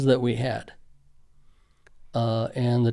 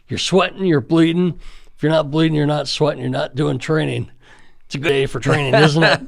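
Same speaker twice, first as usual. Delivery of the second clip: loud, higher, hyped-up drill instructor; drill sergeant voice; 3 semitones higher, 6 dB louder than usual. Retold or imitated. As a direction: bark it like a pumped-up drill sergeant. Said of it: "you sounded just like my drill sergeant".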